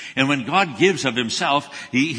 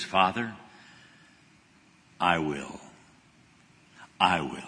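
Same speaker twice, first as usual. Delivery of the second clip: low, soft and slow "Father, I will"; Jesus at the close of his prayer, hushed and solemn; 7 semitones lower, 7 dB quieter than usual. Retold or imitated. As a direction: lay it down quietly before God.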